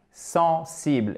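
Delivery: usual speaking voice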